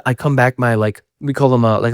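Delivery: ordinary delivery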